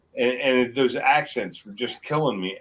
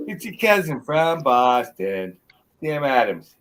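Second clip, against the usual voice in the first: slow, louder Boston accent; 3 semitones higher, 3 dB louder, slower than usual. Shown as a demonstration. Correcting friend's try, slow louder Boston accent. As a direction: show how the Boston accent really goes. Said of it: "Yeah, close, exactly".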